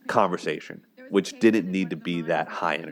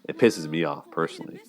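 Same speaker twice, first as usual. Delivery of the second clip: ordinary delivery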